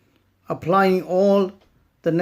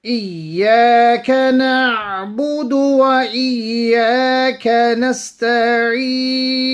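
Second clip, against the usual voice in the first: melodic tajweed recitation, a touch higher; Quran recitation; 6 semitones higher, 3 dB louder than usual. Acted. Teacher reciting the verses for students, melodic and measured.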